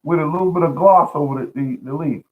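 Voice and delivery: an impressed neighbor admiring the leaves, up high. Retold or imitated. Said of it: "He said".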